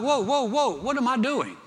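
high-pitched retold alarm. Preacher recalling the scary video clip, alarmed and high. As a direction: pull back in mock panic, pitch up high.

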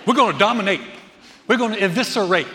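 loud locker-room bravado; puffed-up athlete. Acted. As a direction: chant it like a hammed-up team hype-up. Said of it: "walking around with their chest puffed out".

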